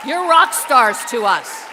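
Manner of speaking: big, drawn-out rallying shout; emphatic proclamation. Real, not acted. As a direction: proclaim it to the whole room. Repeats it emphatically to the whole room.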